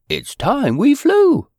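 high, slow sing-song call; excited call. Acted. Character calling out to fly.

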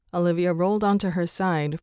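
ordinary delivery

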